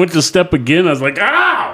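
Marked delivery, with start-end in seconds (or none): 1.2-1.7 high yelp of pain, retold; a sharp retold ouch at a much higher pitch; mock pained ow. Telling friends about the hummingbird, a high mock yelp. Retelling stepping on plastic beak.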